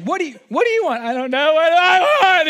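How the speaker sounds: high, loud hammed-up whine; mock crying husband, high and loud; whiny husband. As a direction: wail it like a helpless man. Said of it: "It'd be like".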